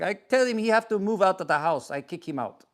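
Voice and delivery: imitating a father's heavy Russian accent, gruff and matter-of-fact; thick Russian accent, dropping at the end; father explaining he kicked his son out in heavy Russian accent. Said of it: "With the heavy Russian accent".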